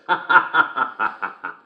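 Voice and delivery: long staccato stage laugh; hearty laugh. Acted. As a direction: laugh heartily at your own joke. Laughs at own joke's punchline.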